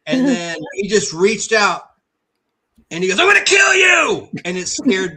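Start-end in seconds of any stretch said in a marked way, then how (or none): 3.2-4.3 sudden high, very loud roar; menacing shout. Retold or imitated. Retelling the masked neighbor's prank on him, a scary shout. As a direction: burst out yelling to terrify a child.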